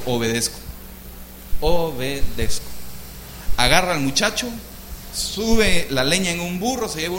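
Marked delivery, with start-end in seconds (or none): 0.0-2.7 low, slow "obedezco"; solemn obedience. Retold or imitated. Abraham submitting to God, low and slow. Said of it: "La tercera dijo".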